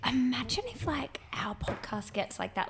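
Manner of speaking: hushed, whispery wondering; slow, soft, breath-only murmur; retold awed aside. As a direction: whisper it slowly, as a daydream shared with a friend. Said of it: "I remember being like".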